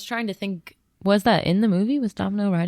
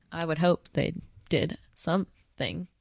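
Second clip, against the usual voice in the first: robot voice; 2 semitones lower, 6 dB quieter, slower than usual. Real, not acted. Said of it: "Why did I just sound like a robot?".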